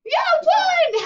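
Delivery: high-pitched, loud cheering; excited cheer. Real, not acted. Teacher reacts to a child's counting answer, excited cheer. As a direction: celebrate it with a big, high whoop.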